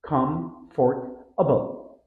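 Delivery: comfortable slowly as com-fort-a-ble, every syllable said; syllables separated. Shown as a demonstration. Teacher imitating learners' attempt, syllables separated. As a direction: spell out all four beats of comfortable. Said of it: "try to pronounce each syllable separately".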